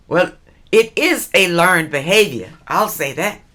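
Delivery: ordinary talk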